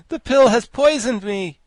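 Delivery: high, loud alarm; anguished high-pitched gasp; gasping in pain. A narrator's reading. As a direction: cry out in agony and panic. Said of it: "he gasped".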